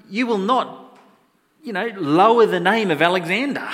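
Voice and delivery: loud, high, forceful forbidding; indignant command. Acted. Alexander the Great forbids soldier lowering his name, indignant.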